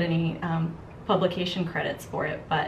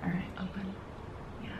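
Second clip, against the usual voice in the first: whispered instruction; whispering; 14 dB quieter, faster than usual. Real, not acted. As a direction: breathe it softly to the client. Applying lipstick, whispered instruction.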